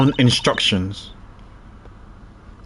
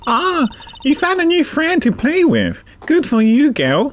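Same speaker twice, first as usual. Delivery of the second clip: higher, nasal praise for the elephant; nasal animal tamer; 8 semitones higher than usual. Acted. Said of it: "let's give her a nasal one".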